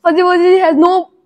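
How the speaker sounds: high, loud rushed tongue twister; tongue twister speeding up. Shown as a demonstration. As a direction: race through the rhyme fast. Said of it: "really fast".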